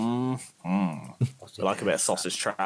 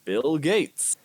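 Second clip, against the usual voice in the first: lightly raised put-on American accent; a hint of a cowboy American ordering food; mock American Bill Gates; 4 semitones higher, much slower than usual. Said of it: "you just missed Bill Gates".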